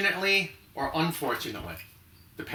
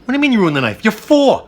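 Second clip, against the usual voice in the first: louder, higher mock outrage at a little kid; mock scolding; 8 semitones higher, 11 dB louder than usual. Host scolds imagined child, hammed-up loud outrage. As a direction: scold like a shocked parent.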